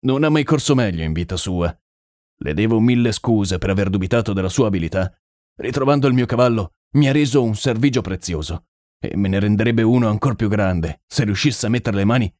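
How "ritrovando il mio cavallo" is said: plain reading voice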